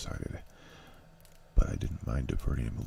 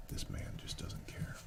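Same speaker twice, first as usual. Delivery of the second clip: whisper; 11 dB quieter than usual. A narrator's reading. Warden's letter, final warning.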